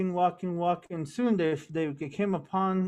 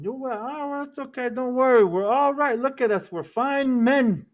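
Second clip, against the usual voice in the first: higher and louder, bragging that they're fine; boastful twins; 6 semitones higher, 5 dB louder than usual. Acted. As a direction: puff up like young men sure of themselves.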